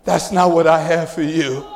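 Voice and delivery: low, measured voice of God; God's deep, settled reply. Retold or imitated. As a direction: answer low, as God speaking to a son. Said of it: "And God says".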